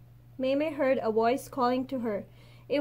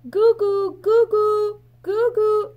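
high, loud chicken call; hen call at the top of her voice; 10 semitones higher, 10 dB louder, slower than usual. A narrator's reading. Mei Mei calling her hen Daisy, high and loud. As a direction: call the hen home as loud as you can. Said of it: "she called at the top of her voice".

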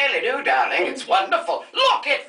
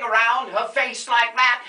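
normal talking voice

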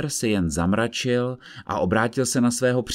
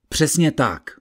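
called out; 6 semitones higher, 4 dB louder, slower than usual. Acted. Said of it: "volal soudce".

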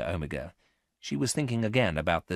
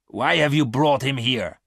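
mildly angry, louder and higher challenge; 7 semitones higher, 7 dB louder than usual. A narrator's reading. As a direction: raise the voice in annoyed demand. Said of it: "Garth asked Miranda angrily".

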